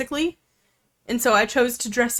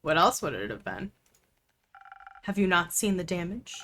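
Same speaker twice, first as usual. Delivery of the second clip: hushed suspicious voice; 4 semitones lower, 7 dB quieter than usual. Acted. Conspiratorial character line.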